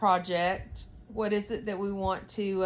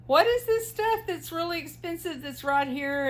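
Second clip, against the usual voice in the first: high, puzzled quoted voice; imitating brother-in-law; 9 semitones higher than usual. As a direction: mimic his baffled question. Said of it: "my brother-in-law called me and said".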